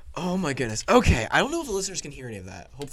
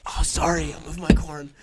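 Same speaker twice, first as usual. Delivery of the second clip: usual speaking voice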